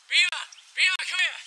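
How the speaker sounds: loud call across the yard. Acted. Host calling his sidekick Beaver over, loud call. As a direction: shout for him to come.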